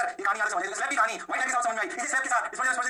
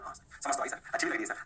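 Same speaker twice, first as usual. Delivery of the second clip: low, soft pupil voice; student reply; 7 semitones lower, 4 dB quieter, faster than usual. Acted. Student assuring sir they understood, low student reply. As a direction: agree quietly as a student.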